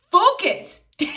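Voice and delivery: high, loud snap of Focus; sharp self-call. Real, not acted. Scolding herself for getting distracted, loud sharp call. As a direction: yank yourself back on track out loud.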